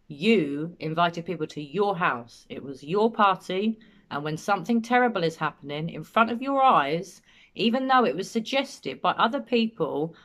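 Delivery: jab every word like pointing a finger; emphatic accusation. Retold or imitated. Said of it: "he points to give it more emphasis".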